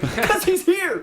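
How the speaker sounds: high, thrilled announcement; excited self-quote. Retold or imitated. S1 recalling spotting Mel Gibson at the Oscars, high and thrilled. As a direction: squeal it like a proud fan. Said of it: "I was like".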